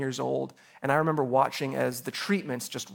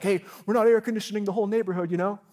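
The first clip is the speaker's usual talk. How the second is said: exasperated parent; 5 semitones higher, faster than usual. Retold or imitated. Parents annoyed at open door.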